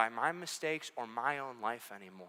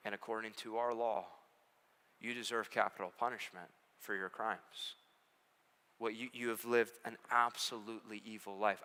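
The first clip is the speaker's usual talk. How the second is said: choked up; 4 semitones lower, 6 dB quieter than usual. Acted. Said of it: "the judge gets a little choked up".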